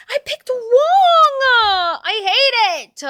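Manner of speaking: loud, high, drawn-out wail; anguished wail. Real, not acted. Player who picked wrong in the game, wailing. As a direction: cry out in over-the-top despair, stretching the words.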